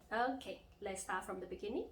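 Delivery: ordinary talk, nothing marked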